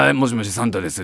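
low, lazy off-duty Santa voice. Retold or imitated. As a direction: answer like a tired man off work. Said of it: "っていうオフのサンタ". (気の抜けたオフのサンタの声)

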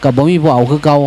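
usual speaking voice

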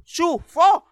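loud high shoo like chasing off a stray; shooing shout. Acted. Comic shooing off a cheerful person, loud shoo. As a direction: bark a sharp shoo. Shooing away happy people.

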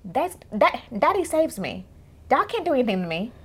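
high, sassy untouchable boasting; smug favored daughter. Acted. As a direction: act out daddy's girl daring anyone to challenge her. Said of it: "So, like, she knew".

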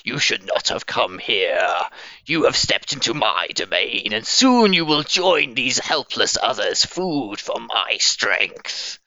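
booming monster voice, projected; unearthly voice. Acted. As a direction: project it like a hulking brute. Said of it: "she's still got the voice going on, she's still got that projection".

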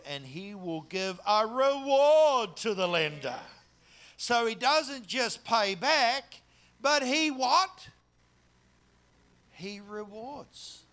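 loud, high, slow emphatic preaching; preaching at full force. Acted. Congregation hearing the reward promise, fervent preaching. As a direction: build it up and drive it home.

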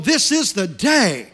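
high, loud exultant declarations in punchy bursts; fired-up preacher, each line driven high and loud; full-force preaching. Acted. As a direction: preach it like a rousing morning battle cry.

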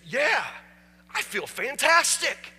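high, loud and slow, bursting with joy; overjoyed. Acted. The changed man answers the pastor, a loud, slow, joyous shout.

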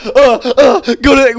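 loud, high, stammering mock panic; hammed-up flustered stammer; retold fluster. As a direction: flail for a hiding place. Retold flustered reply about hiding.

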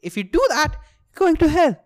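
high, loud mock threat; threatening God. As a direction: play God yelling a warning. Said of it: "then he's like".

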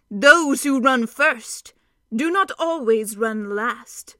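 slow, weighty proverb; measured solemn wisdom; grave pronouncement. Acted. Centaur counselling the council, slow and solemn.

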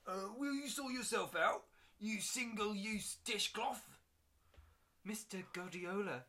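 secretary snapping at the visitor; sassy secretary. Acted.

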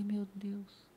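whispered Meu Deus; despairing whisper. Retold or imitated. Patient told months off work, whispered dismay. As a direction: breathe it out in dismay.

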